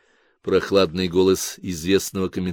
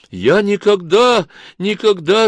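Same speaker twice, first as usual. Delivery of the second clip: much higher, slow tearful cry; sobbing; 9 semitones higher, 4 dB louder, slower than usual. Acted. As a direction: sob out the denial. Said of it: "рыдала Тамарка". (рыдая)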